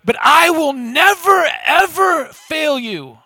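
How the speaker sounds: loud, high boast over the others; defiant Peter. Acted. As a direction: dismiss the rivals, then stretch out the defiant vow. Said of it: "He turns on these other guys".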